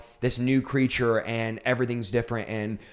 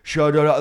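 mock tongues babble, louder stuttered syllables; imitated babbling; 2 semitones higher, 5 dB louder than usual. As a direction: imitate babbling in an unknown language. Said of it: "I'm babbling this".

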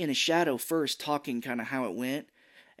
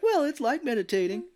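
much higher, giggly excuse; laughing teen; 11 semitones higher, 4 dB louder than usual. Retold or imitated. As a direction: defend the dance, laughing. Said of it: "Leonardo's like".

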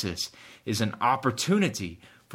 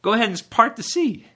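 higher, louder, comic nudge to get on with it; exasperated God; 5 semitones higher, 6 dB louder, faster than usual. Acted. God urging Moses to act at the Red Sea, exasperated. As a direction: play God stating the obvious, energetically. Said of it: "He's like".

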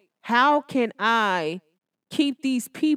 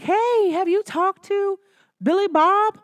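slow, higher put-on friend voice, sly and innocent; nosy friend; 8 semitones higher, slower than usual. Friend on the phone asking about ex, sly higher voice. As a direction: play the fake-innocent friend fishing. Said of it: "she might be like".

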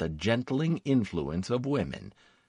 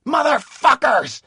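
loud, high outburst of rage; enraged snarl; 8 dB louder than usual. A narrator's reading. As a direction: snarl it at full volume. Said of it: "Cosaglo snarled".

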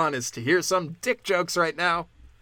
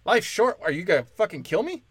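mock incredulous; 4 semitones higher than usual. Comic taking a parting remark as a threat, incredulous. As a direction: throw the phrase back as if threatened.

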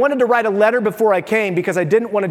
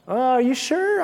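pesky doubting question; 6 semitones higher, 3 dB quieter than usual. Pretend. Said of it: "They will ask you pesky questions".

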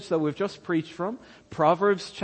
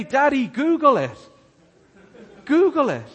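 higher, louder imitation of a child; lightly voiced Simeon; 6 semitones higher, 7 dB louder than usual. Son telling his dad to look it up, lightly voiced child. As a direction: call it out like an insistent kid. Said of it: "Simeon said to me the other day".

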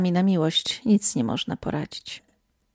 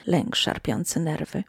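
ordinary delivery

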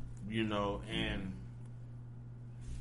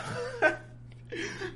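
high, squealing laugh; real high-pitched laugh; burst of laughter. Real, not acted. Laughs at cohost's joke.

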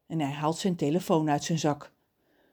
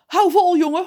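high, loud, weepy cry; tearful call; 13 semitones higher, 11 dB louder than usual. A narrator's reading. Father encourages his kidnapped son, tearful shout. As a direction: call out as if crying. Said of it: "zijn vader met zijn huilstem roepen".

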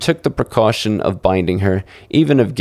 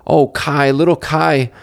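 sighed; 6 semitones higher than usual. A narrator's reading. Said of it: "Gerda sighed".

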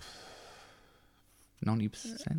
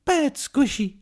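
high, loud cartoon fish voice; squeaky high cartoon fish; Dory voice; 6 dB louder, slower than usual. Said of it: "[name]'s movie impression".

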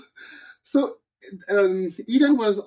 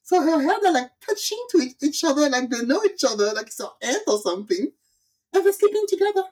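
incredulous; 8 semitones higher than usual. Retold or imitated. Excited telling of absurd scene.

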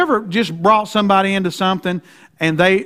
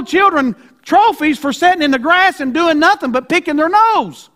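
raised, high preaching voice; fiery preaching; 8 semitones higher than usual. Acted. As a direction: preach it hard to the congregation. Preaching at full force.

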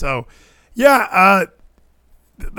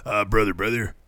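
low, softer bro greeting; brother-brother catchphrase; 5 semitones lower, 4 dB quieter than usual. Shown as a demonstration. Host demonstrates his greeting to guys at work, low bro voice. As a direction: drop low for a mock dude-to-dude hello. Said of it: "I do this a lot".

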